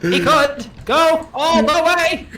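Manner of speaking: high, slow, hammed-up forties announcer; old radio announcer. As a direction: boom it out like a vintage radio commentator. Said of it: "an old-timey radio announcer in here to do this for us".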